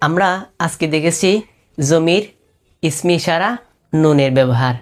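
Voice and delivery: ordinary talk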